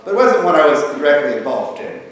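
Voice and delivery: normal talking voice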